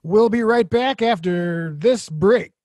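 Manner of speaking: slow, projected host read; show host. Acted. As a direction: announce it to the audience, stretched out. Host throws to break and back.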